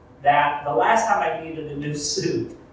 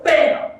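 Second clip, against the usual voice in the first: mock punch; 9 semitones higher, 7 dB louder than usual. Brothers punching.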